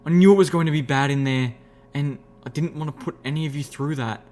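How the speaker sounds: desperate apology. A narrator's reading. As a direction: confess and plead with your friends desperately. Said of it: "I looked at my friends desperately".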